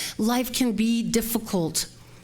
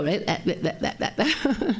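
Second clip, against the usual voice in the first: flustered stammer. Retold or imitated. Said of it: "that's when I go".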